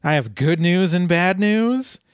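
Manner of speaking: high, swooping mock-distressed dean; hammed-up dithering administrator; the dean, flustered. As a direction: swoop up high in comic dismay. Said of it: "and he is like".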